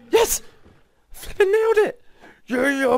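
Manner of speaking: high, loud victory shout; triumphant cheer. Real, not acted. Player cheering after killing the mob boss, loud high whoop. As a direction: whoop as the boss finally drops.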